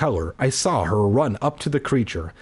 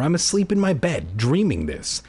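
ordinary delivery

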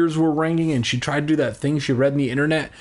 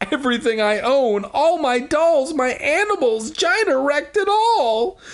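furious cry; 13 semitones higher than usual. Acted. Willa telling her mother Gina wrecked her things, furious high cry. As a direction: hurl the blame at Gina. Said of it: "she cried furiously".

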